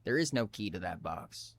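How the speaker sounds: low, soft and emotionless; flat refusal, no feeling given; without emotion. Acted. Captive driver answers Jesper's demand without emotion. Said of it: "Without emotions".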